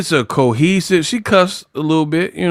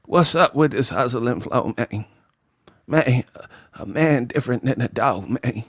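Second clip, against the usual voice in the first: softer, lower laid-back Terrence Howard voice; Terrence Howard impression; 4 semitones lower, 7 dB quieter than usual. Mocking Terrence Howard for repeating the same character, hushed and low. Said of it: "Bro, Terrence Howard, same character".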